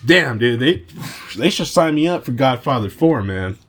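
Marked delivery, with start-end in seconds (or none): none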